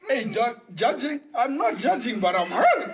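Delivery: high, loud protest of hurt; wounded protest. Real, not acted. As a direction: protest loudly, voice climbing as if wounded. Mock-wounded reaction after the others judge.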